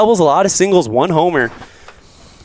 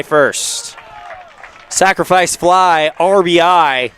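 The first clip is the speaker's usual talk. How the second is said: excited play-by-play; 4 semitones higher than usual. Real, not acted. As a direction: build excitement on the fly ball. Live sacrifice fly call.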